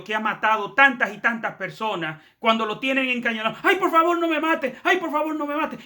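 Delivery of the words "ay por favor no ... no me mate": much higher, mock pleading for his life; imitated tearful begging. Pastor imitating a cornered hitman, high mock begging. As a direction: play the hitman at gunpoint begging, half-crying. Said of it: "y llorando".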